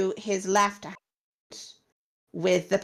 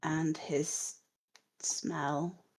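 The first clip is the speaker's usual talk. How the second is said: soft, halting corpse voice with long gaps; faint and slow, groping for each detail; dead woman answering; 6 dB quieter, much slower than usual. Acted. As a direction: give it out word by word, as if from beyond the grave. Dead Miletta describes her murderer.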